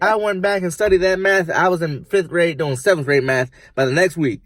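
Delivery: loud, high, laughing boast; excited bragging. Acted. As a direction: crow about it, cackling. Rambler brags, laughing.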